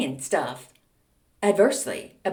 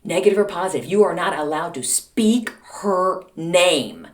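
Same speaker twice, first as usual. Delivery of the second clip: menacing warning; 4 dB louder than usual. Retold or imitated. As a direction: imitate mom laying down the law word by word. Mother's threat builds.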